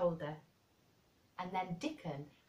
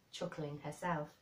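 usual speaking voice

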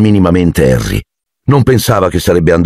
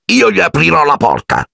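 put-on child's line; 11 semitones higher than usual. A narrator's reading. Dudley rehearses his part.

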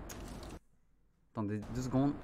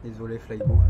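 normal talking voice

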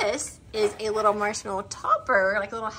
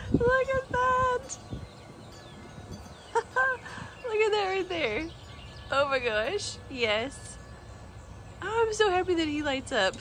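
delighted cooing; 7 semitones higher, 4 dB quieter, slower than usual. Real, not acted. Delighted, laughing at glowing figure.

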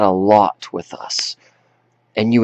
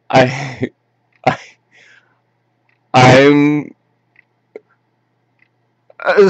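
broken, high sobs between halting words; sobbing plea; 5 semitones higher, much slower than usual. Acted. Grown child apologizing to Dad, sobbing. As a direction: choke out an apology in bursts, voice jumping high.

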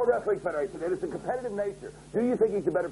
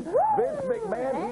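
drawn-out, much higher ring-announcer call; announcer intro; much slower than usual. Acted. Host introducing the chairman, in a slow, soaring announcer call. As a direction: stretch the name out like a ring introduction.

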